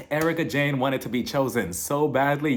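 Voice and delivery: ordinary talk, nothing marked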